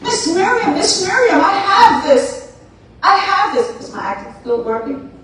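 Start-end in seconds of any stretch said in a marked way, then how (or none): 0.0-3.7 much higher, eager calling out; excited calling. Acted. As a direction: run in breathless with good news. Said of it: "she goes back running".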